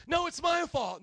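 high, loud eager protest; mock insistence. Spouse arguing to take the blame, hammed-up insistence. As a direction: jump in to claim the blame.

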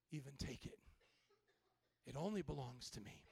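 low, soft, intimate words; tender God. Acted. God tells Joe of the place in his heart, tender and soft. God speaking privately to the preacher.

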